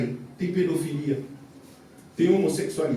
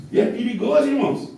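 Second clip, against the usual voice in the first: loud, high shouted sermon; fiery preaching; 7 semitones higher, 5 dB louder than usual. Acted. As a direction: thunder it from the pulpit. Preaching at full force.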